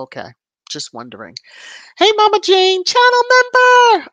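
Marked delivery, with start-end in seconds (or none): 2.0-4.1 much higher and louder, a thrilled welcome; excited shout. Real, not acted. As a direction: shout out the new member with delight. Greeting a new channel member.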